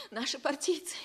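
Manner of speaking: normal talking voice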